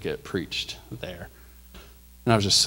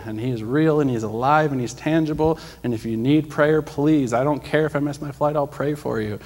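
loud, higher preaching to a crowd; preaching aloud; 5 semitones higher, 6 dB louder than usual. Retold or imitated. Preaching healing to the travelers waiting in line, loud and fervent. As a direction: proclaim it to the whole terminal.

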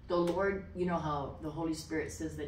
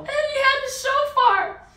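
very high, loud squeal; elated, high-pitched reveal; gleeful exclamation; 11 dB louder than usual. Real, not acted. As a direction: cry it out in sheer delight. Delighted repeat of climax.

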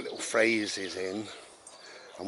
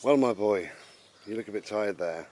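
ordinary talk, nothing marked

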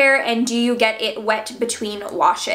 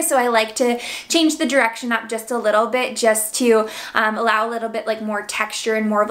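usual speaking voice

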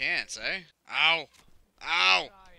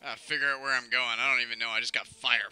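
usual speaking voice

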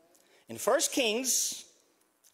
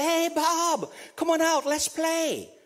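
put-on higher, louder kids calling a friend out to play; boyhood friends calling; 9 semitones higher, 4 dB louder, slower than usual. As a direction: call up to the window like a boy. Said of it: "my friends will go by and say".

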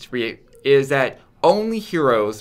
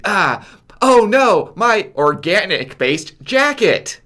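high, loud and slow mock dismay; hammed-up movie line; 6 semitones higher, 6 dB louder, slower than usual. Mocking a movie line they hate, hammed-up loud dismay. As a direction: overact the alarm about the jacket. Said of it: "Hate that line from the movie".

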